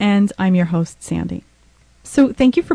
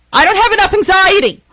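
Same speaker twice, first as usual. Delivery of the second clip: exasperated sarcasm, pitched up; sarcastic; 8 semitones higher than usual. Real, not acted. Sarcastic repeat, higher, louder.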